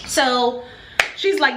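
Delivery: ordinary talk, nothing marked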